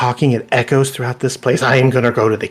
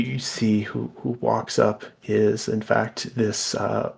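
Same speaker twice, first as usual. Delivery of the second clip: normal talking voice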